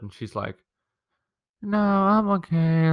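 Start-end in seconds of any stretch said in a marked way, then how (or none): 1.6-2.9 mock sad sister. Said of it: "trying to get attention from being sad".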